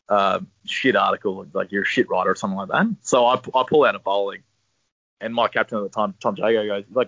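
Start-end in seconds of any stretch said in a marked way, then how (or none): none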